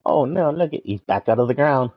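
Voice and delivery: ordinary talk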